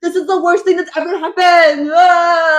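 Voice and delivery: loud, high melodramatic wail; mock wailing. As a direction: overdo the despair, ending in a cry. Mocking Tamlin's dramatic complaint.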